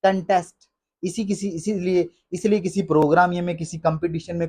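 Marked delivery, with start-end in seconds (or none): 0.0-0.6 कंटेस्ट as kun-TEST; short uh in कंटेस्ट; correct pronunciation. Shown as a demonstration. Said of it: "इसको पढ़ते हैं". (कंटेस्ट सही, कन-टेस्ट)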